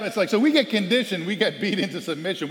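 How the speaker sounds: ordinary talk, nothing marked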